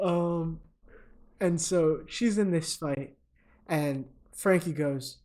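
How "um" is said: ordinary delivery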